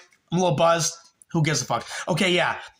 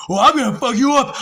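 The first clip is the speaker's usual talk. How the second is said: higher, louder mock threat from the old actor; threatening old man; 7 semitones higher, 6 dB louder than usual. Old groundkeeper actor threatening the narrator, loud mock menace. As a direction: sneer a threat at him. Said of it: "He's like".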